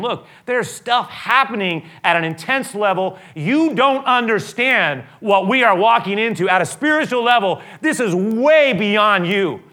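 loud, high, slow urgent warning. Acted. Jesus warning the disciples of what is coming, loud. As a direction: drive home the danger.

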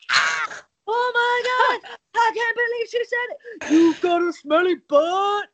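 high shocked squeal, far off; shrieking gasp. Retold or imitated. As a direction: shriek like a giggly kid. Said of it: "is like".

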